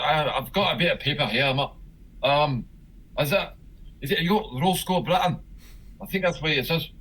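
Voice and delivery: really bad put-on Scottish accent. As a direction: fumble through a note in fake Scottish. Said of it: "that really bad Scottish accent".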